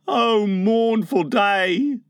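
high, slow, mournful wail; lament. Acted. Second boy mourns Wendy, slow lament. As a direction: draw it out in plaintive grief.

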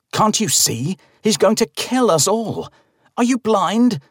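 high, desperate shouting; frantic shout. A narrator's reading. Narrator shouts at the old man, high and frantic. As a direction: plead at him, panicked. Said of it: "I shout as the man tries".

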